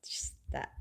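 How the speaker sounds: embarrassed whisper; shy whisper. Real, not acted. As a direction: whisper it shyly. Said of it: "Now I feel shy to repeat it".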